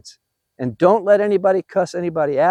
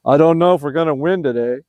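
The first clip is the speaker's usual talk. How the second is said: mock glum, lower-pitched mumbled doubt; frowning doubt; 3 semitones lower than usual. Coach imitating a frowning player before a game, mock glum. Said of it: "a frown on your face going".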